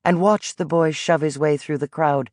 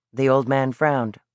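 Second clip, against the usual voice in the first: ordinary delivery, nothing marked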